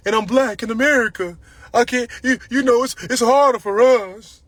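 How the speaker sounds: loud, high mock complaint; excuse-making. Acting out people's excuses.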